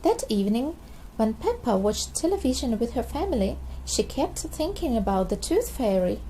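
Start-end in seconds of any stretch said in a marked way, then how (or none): none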